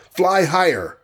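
high-pitched urgent order; gasped, urgent command. A narrator's reading. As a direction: call it out high, as a gasped warning. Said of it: "gasped the Ant-Man".

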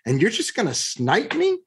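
high-pitched, indignant retelling of a plea; retold indignant pleading. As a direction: replay the outrage of being sniped out of a deal. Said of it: "I literally pleaded with him and said".

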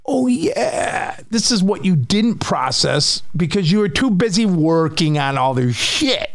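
high, sing-song sneering inner voice; mock brain voice. His own brain taunting him about unprocessed stress, mocking and high. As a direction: play the smug brain catching him out. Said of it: "And then I was like".

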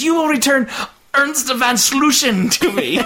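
high, drawn-out villainous demand; over-the-top outburst verging on laughter; hammed-up yelling. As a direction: stretch the name out in mock fury. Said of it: "every time he yells".

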